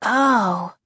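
a sighed, deflated reply. A narrator's reading. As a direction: let it out as a sigh. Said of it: "Casey sighed".

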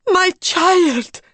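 high, loud, yearning; high emotional cry; anguished cry. A narrator's reading. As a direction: cry out to the lost child. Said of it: "cried Madame X".

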